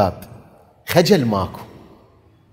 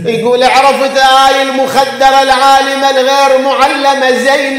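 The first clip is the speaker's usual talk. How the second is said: high, loud mourning cry to the crowd; full-force lament preaching; 11 semitones higher, 6 dB louder than usual. Acted. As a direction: preach the tragedy at the top of your voice. (خطابة حسينية بأعلى صوت)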